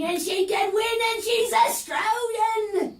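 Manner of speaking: climbing high and loud, slowing on Australian; rising excitement. Acted. Fan gushing about her blinking hero, voice climbing. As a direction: build to a squeal of pride.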